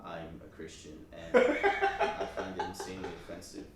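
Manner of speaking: low, soft, deadpan politeness; monotone work voice. Retold or imitated. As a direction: imitate yourself objecting in a quiet, lifeless monotone. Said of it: "like, monotone".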